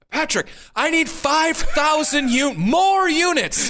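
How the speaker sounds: high, slow, drawn-out demand; imitating Jose. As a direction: stretch out the big order like the client. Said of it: "in the third order he goes".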